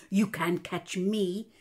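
ordinary delivery

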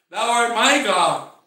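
loud, slow declaration of faith; emphatic preacher's confession, drawn out; proclamation. Acted. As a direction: ring it out slowly and firmly.

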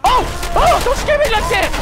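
high, loud sudden fright; startled scream. Real, not acted. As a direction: shriek from a jump scare. Jump scare.

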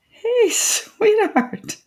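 high, slow, sing-out hello; doting mother on the phone; affectionate greeting. Acted. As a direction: coo a drawn-out hello to a daughter.

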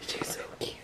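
tender voiceless whisper; whispered awe at the newborn. Real, not acted.

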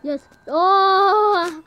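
ordinary delivery

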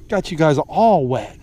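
ordinary talk, nothing marked

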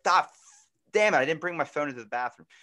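loud, high burst of mock exasperation; frustrated. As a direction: curse at yourself for missing the call. Acting out missing a phone call.

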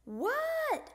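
high, loud, drawn-out call back; hollered reply. A narrator's reading. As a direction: yell back across the house. Froggy yells back to Dad.

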